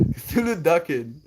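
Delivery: normal talking voice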